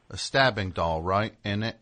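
put-on low, quiet Cockney English accent. Trying out a British album title, low Cockney English accent. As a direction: say it in understated Cockney. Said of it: "You do a great English accent".

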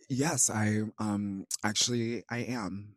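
hushed, hesitant pretend announcement; soft, halting faux modesty; mock-coy confession. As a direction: drop the volume as if shyly admitting a secret. Coyly playing along with joke casting.

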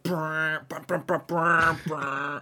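sputtered brassy movie sting; mock soundtrack blasts; imitated villain music. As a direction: mimic an ominous entrance theme with the lips. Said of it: "they'll play, like".

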